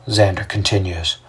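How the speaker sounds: plain reading voice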